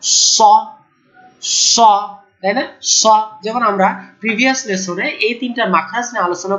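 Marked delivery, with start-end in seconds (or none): 0.0-3.1 স with a full, heavy hiss, mouth filled; letter sound demo. Shown as a demonstration. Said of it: "যদি এইটা সদকে জবর উচ্চারণ করেন". (স উচ্চারণ, মোটা শীষ)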